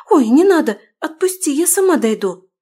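startled, high and loud protest; frightened cry. A narrator's reading. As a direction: squeal in fright. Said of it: "Испуганно дёрнулась я".

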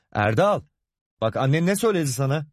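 tiz, hızlı oğluna sesleniş; adını uzatarak çocuğa seslen; seslenme. A narrator's reading. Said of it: "diye seslenip". (baba, oğluna seslenerek)

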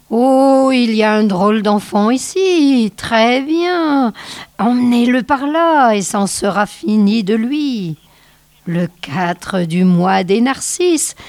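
high, eerie crone wailing at the wind; old snow witch. Acted. Old witch goading her wolves through the blizzard, high eerie howling. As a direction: howl it out like a cruel old witch urging the storm.

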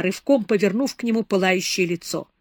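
plain reading voice

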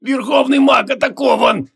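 high, slow strained call; mock-heroic cry of a winded mage; wheezed battle cry. A narrator's reading. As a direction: raise the alarm in a drawn-out high voice. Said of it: "прокрепел он".

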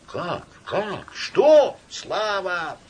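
crowd voices. A narrator's reading.

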